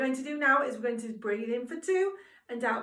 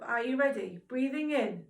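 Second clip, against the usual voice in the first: ordinary delivery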